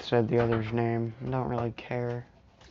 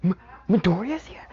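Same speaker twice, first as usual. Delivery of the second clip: surprised whisper; 5 semitones higher, 4 dB louder than usual. Retold or imitated. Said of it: "everyone's like".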